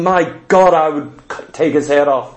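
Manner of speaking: a hint of an angry soldier's low voice; low soldier growl. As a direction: mutter it like a man gripping his sword. Soldier gripping sword, voiced quote.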